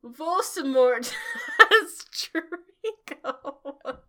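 high, drawn-out shout breaking into laughter; mock shout. A narrator's reading. Two students warning Dumbledore together, shouting. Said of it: "We shouted at the same time".

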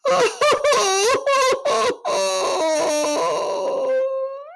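high, drawn-out bawling howl; loud hammed-up wail of misery; sobbing wail that tails off; long wailing sob. A narrator's reading. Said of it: "sobbed Mister Tumnus".

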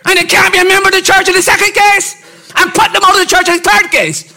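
defiant preacher's proclamation; preaching at full force. Acted. Preacher telling a conference story.

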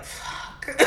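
whispered mock curse; barely-there whispered swear; deflated whisper. Worker realising the dream job's downside, deflated whisper. Dream job turns to dread.

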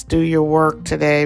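usual speaking voice

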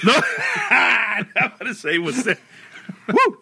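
laughing high-pitched at the sourness. Real, not acted. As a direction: squeal through laughter after a sour bite. Reacting to sour lemon bite.

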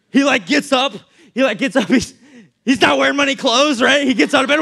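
ordinary delivery

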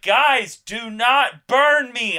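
high, loud, slow plea; hammed-up desperate begging; mock wail to be spared; Faramir protesting. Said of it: "Faramir trying to communicate that he's not dead".

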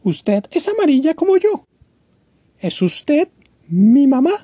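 put-on high, small childlike bird voice; a tiny chick asking hopefully; little bird Choco. Choco asks the giraffe. (pajarito Choco, voz aguda e infantil)

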